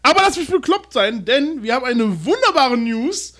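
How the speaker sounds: giddy big announcement; excited. Real, not acted. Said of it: "Ich bin heute bekloppter als sonst".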